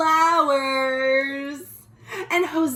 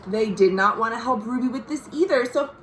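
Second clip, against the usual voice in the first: plain reading voice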